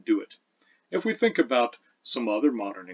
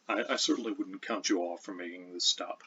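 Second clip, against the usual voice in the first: normal talking voice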